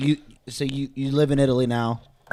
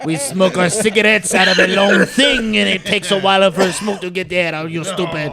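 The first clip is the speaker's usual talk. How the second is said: put-on French accent; 3 semitones higher, 5 dB louder than usual. Frenchman explaining his long cigarettes to Americans, French accent. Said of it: "I could do French".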